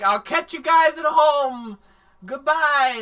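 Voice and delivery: high, loud fake-bright goodbye; hammed-up cheery goodbye; forced cheerful shout. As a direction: call out a brave farewell. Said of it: "he's like".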